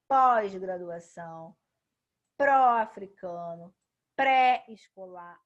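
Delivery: pós, pró, pré said loud and open; stressed open prefix. Shown as a demonstration. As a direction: punch each prefix, slow and strong. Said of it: "ele sai mais forte".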